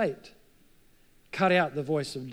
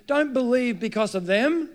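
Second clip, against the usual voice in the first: normal talking voice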